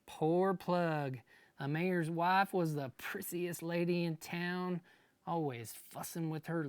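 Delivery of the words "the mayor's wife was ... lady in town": usual speaking voice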